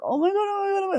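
high, panicky mock gasping before going on stage; retold stage panic. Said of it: "I was so scared".

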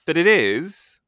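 game-show style announcement of the answer; excited reveal. Real, not acted. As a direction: build up and announce it like a prize.

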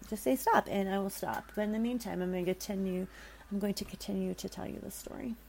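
plain reading voice

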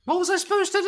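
high-pitched comic indignation; indignant protest. Real, not acted. Defending himself over the massage mishap, high indignant protest. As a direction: plead your case in a squeaky protest.

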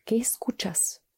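whispered close to the ear; right in your ear. Acted. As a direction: breathe the question softly. Whispered question in meditation.

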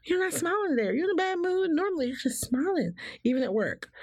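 high, mock-worried chatter; imitating concerned coworkers. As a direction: fuss over her missing smile. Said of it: "people will be like".

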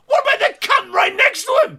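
very high, incredulous yelling; comic high-pitched outrage; outraged rant. Acted. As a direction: shriek the comeback in disbelief. Outraged comeback to the film's defenders.

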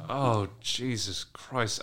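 ordinary talk

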